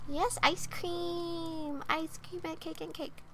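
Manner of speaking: put-on silly childlike cheer.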